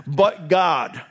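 "But God" loud and high, falling at the end; shouted refrain. Acted. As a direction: ring it out over the fears. Refrain shouted amid worries.

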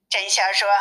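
ordinary delivery

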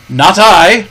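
the captain coolly testing the pirate; Captain Smollett. Acted. Captain refuses Silver.

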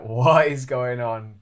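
loud, drawn-out retold disbelief; incredulous, slow and emphatic; bewildered disbelief. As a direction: replay your bafflement, stretching the words loudly. Acting out his bewildered reaction.

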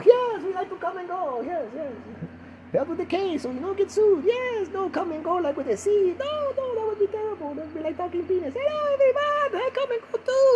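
very high mock Eastern European voice; Eastern European accent. Foreign host praising American chains, Eastern European accent. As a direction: falsetto Eastern European fan. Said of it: "I sound like I'm some Eastern European country".

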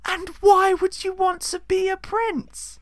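slow, very high demanding mother; incredulous dame interrogation; scolding pantomime dame. Acted. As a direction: draw out the question in falsetto. Plays scolding Mother Jamalio.

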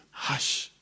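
a soft breathy hush to the storm; calm the sea with one whispered word. Acted. Jesus calms the storm.

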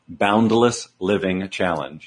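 slow, careful enunciation so it can't be misheard; slowly spelled-out program name; slow, deliberate. Shown as a demonstration. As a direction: say each word slowly and separately. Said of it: "I'm gonna say it slowly 'cause everybody thinks I'm saying balanced".

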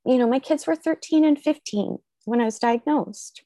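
ordinary delivery

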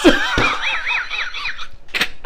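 high-pitched, loud burst of laughter; shrieking laugh. Real, not acted. Host jokes about kicking mom off the list, shrieking with laughter. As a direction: crack up helplessly, squealing. Cracks up at own joke.

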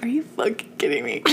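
hushed, breathy whisper of disbelief; disbelieving whisper. Retold or imitated. Disbelief at mom's plan.

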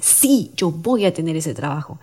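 emphatic, determined affirmation; with conviction. Shown as a demonstration. Host showing how to affirm a wish, with conviction. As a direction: stress the SÍ and mean every word. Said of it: "A comparación de cómo lo dices".

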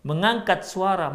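usual speaking voice

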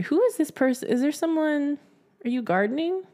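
high, baffled questions; incredulous, high. Retold or imitated. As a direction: squeak out confused questions. Reacting to video, high voice.

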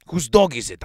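high, loud, impatient question; loud demand. A narrator's reading. Superintendent demanding the owner from the crowd, loud demand. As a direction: demand an answer from everyone.

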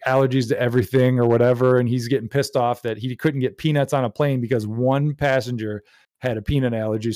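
ordinary talk

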